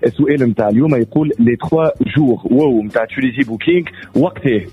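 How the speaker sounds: upbeat quiz host voice; game show host. Acted. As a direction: announce the winner like a quiz host. Radio contest with a caller.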